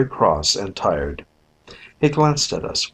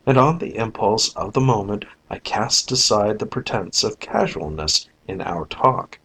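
ordinary delivery

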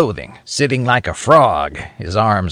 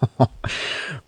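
breathless rasping chuckle; dry cackle before the story; 8 dB quieter than usual. Acted. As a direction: wheeze out a sly chuckle. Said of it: "He began with a rasping chuckle".

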